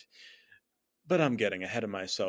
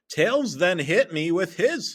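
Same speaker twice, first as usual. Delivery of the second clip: plain reading voice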